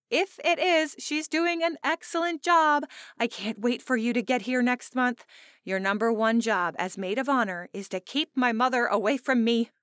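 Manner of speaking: high, loud, lively friend on the phone; bright bride-to-be friend. Acted. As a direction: play the chatty bride teasing about her mother.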